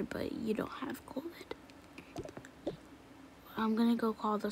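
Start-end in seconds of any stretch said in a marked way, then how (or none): 0.0-1.5 whispered, gentle lie; hushed fake reassurance. Parent rehearsing a lie to the kids, whispered. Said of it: "lie to them and say".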